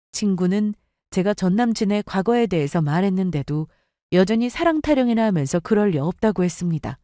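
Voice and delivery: plain reading voice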